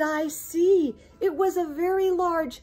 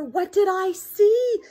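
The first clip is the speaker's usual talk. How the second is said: usual speaking voice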